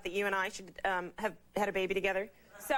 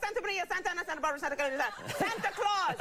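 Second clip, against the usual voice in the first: high, breathless Spanish exclamations; mock Latin lover calling on saints in bed; passionate Latin lover; 7 semitones higher, faster than usual. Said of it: "Yeah, like".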